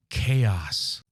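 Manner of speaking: very low, grave single word; acted low, solemn utterance; grave pronouncement. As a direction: drop the voice right down for one ominous word. Acting a grim one-word answer.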